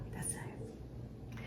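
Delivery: soft whisper of approval; pleased whisper. Real, not acted. To herself, feeling the warm water, a pleased whisper. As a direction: breathe it out, satisfied.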